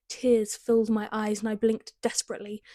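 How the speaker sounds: ordinary delivery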